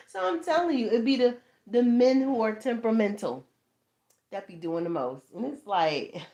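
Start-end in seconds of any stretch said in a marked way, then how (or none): none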